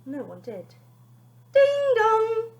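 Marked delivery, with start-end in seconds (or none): 1.5-2.5 high sung doorbell chime, louder; doorbell ding dong. Acted. Narrator imitating the doorbell, high and loud. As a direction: sing it like a doorbell.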